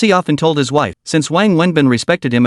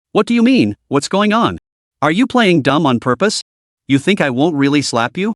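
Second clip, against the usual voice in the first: usual speaking voice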